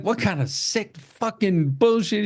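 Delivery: high-pitched mock indignation; hammed-up outraged quote; imitated outrage. Acting out armed man's outrage.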